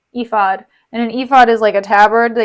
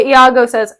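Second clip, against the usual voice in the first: ordinary talk, nothing marked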